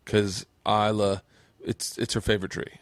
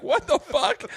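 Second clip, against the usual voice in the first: baffled; slower than usual. Retold or imitated. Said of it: "I was like".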